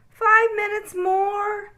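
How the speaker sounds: high, slow pleading little bunny; begging child. A narrator's reading. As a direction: stretch it out begging. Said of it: "begged Okie".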